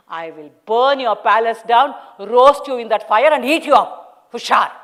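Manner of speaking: loud, rising savage threat; threatening. Acted. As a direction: threaten him with fire. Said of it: "started cursing him".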